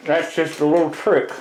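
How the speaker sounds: "That's just a little trick" mumbled around a pencil in the teeth; pencil in mouth. Real, not acted. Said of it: "had a pencil in my mouth".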